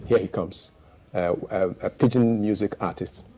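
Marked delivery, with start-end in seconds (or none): none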